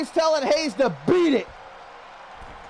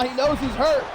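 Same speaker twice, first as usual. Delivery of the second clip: ordinary talk, nothing marked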